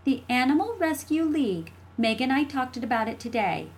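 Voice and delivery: high, smug, silky little sister; smooth as cream. A narrator's reading. Jessie answering after a long pause, smooth. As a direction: glide it out, pleased with yourself. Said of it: "as smooth as whipped cream".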